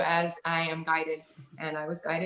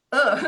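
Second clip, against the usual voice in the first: a sudden louder, higher ugh of protest; loud groan; 6 semitones higher, 7 dB louder than usual. Retold or imitated. Groaning ugh at friend calling her Shelley. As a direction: groan ugh like the name grates. Resisting being called Shelley.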